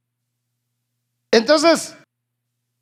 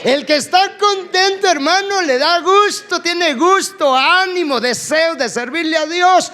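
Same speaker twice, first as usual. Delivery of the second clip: preaching at full force; 5 semitones higher than usual. Acted. Preacher urging his congregation, shouting with rising fervor. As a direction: fire up the congregation from the pulpit.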